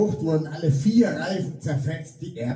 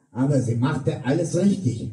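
ordinary delivery, nothing marked

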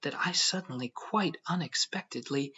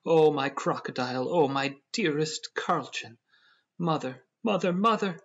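raised, anguished wail for a beloved pet; horrified cry; 4 semitones higher, 4 dB louder than usual. A narrator's reading. Proprietor crying out for his mother, horrified. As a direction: cry out in horror, rising as you call for help. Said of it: "clasped his hands and cried".

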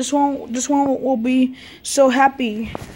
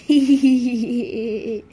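giggling laugh. Pretend. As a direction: run of short giggles. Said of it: "we're laughing because it's funny".

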